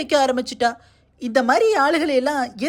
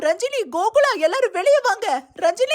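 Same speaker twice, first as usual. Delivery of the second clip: calling out; 7 semitones higher than usual. Acted. Calling family from inside.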